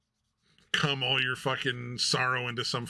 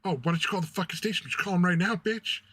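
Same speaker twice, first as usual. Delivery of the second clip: higher, fast and heated, shouting on some lines; mock angry lady; 5 semitones higher, faster than usual. Paranoid lady shouting at a streamer, higher and fast. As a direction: rant at her like a paranoid stranger on the street. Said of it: "'Cause the lady just like".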